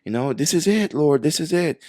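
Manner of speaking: high, loud, breathless this is it; mock thrilled pleading to the Lord; excited prayer. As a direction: urge God on, sure the moment has come.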